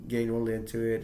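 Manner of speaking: ordinary talk, nothing marked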